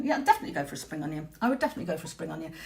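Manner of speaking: fast, low, hushed gabble; rushed and quiet. Real, not acted. Crafter talking to her stream, rushed and hushed. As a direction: rattle it off under her breath. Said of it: "I'm getting myself out of breath".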